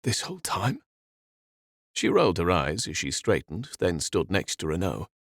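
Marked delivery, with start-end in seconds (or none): 0.0-0.8 a touch of heartbreak in disbelief; quivering disbelief. Acted. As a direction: ask it barely holding together. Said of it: "His jaw quivered".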